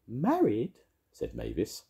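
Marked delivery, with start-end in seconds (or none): 0.1-0.8 high-pitched startled fairy question; piping astonished fairy; surprised fairy. A narrator's reading. Fairy figure reacts surprised.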